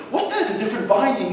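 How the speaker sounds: high, curious what's that; pointing at an odd book; curious high question. Retold or imitated. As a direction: ask it wide-eyed and high. Partner quoting himself curious.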